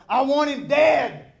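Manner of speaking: high, loud, drawn-out yell; angry mob shout. Acted. Crowd demanding the death of Jesus, angry shout.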